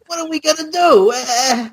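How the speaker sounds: high, slow put-on voice of a clueless dad; mock the sitcom father, high and drawn out; hammed-up high sitcom parent voice. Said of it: "the premise is like".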